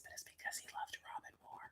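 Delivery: breathy secret whisper, gossip tone; whispered aside. Real, not acted. Sharing celebrity gossip with viewers, in a whispered aside. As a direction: let the juicy bit slip out in a whisper.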